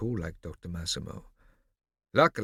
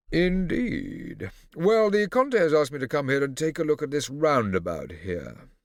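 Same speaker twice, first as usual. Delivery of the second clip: condescending; 7 semitones higher, 5 dB louder than usual. Acted. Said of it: "condescending tone".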